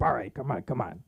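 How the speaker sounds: mock French guy calming people; French-accented coaxing. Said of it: "some French guy had to break it up".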